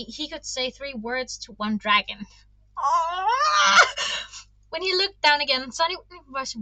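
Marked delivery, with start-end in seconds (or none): 2.8-3.8 sudden high shouted "Ah!"; startled cry. Real, not acted. Reader yelping at a tense moment, sudden high cry. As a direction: cry out in shock at the page. Reader reacts to book scene.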